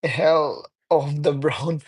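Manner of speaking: usual speaking voice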